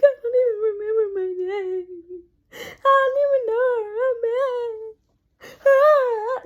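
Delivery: high, wailing fake cry; mock sobbing. Telling her helper she's lost, acted loud sobbing. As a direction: bawl it out, hammed-up and helpless. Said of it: "I was sobbing".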